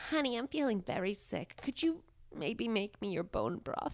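soft, pitiful sick-husband whine; whiny sick husband. Pretend. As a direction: beg like a sick husband with a cold. Plays sick husband asking wife.

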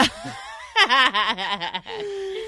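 helpless high laughing fit; burst of laughter. Real, not acted. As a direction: let out a long high cackle of laughter. Reaction to host's planned questions.

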